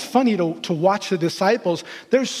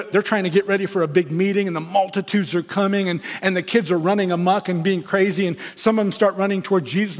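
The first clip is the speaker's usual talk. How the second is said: ordinary delivery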